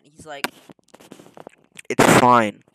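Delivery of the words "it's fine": sudden very loud mock blurt; acted-out loud reassurance; shouted dismissal. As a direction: blast it out to brush off the worry. Friend brushing off warnings, blurted.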